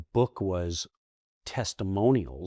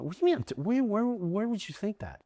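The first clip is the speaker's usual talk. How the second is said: shocked retort; 11 semitones higher than usual. Retold or imitated. His shocked retort, high pitch.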